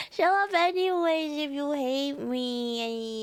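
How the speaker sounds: drawn-out whiny fake sob; playful tearful whine; mock crying. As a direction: plead slowly, pretending to cry. Mock pleading after being hated.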